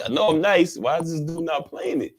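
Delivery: mock-indignant questioning; retold indignation. Retold indignation at being ignored.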